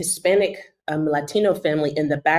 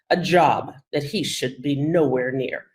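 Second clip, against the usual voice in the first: usual speaking voice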